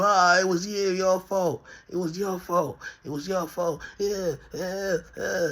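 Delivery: high, whiny mock blaming voice; mocking blamer. Dog owner blaming him for the incident, high mocking whine. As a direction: put on a high accusing whine, taking the mickey.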